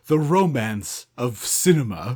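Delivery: low, slow romantic ad voice-over; commercial announcer. Acted. Podcaster joining in on the album commercial, announcer voice. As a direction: sell it like a deep-voiced TV announcer.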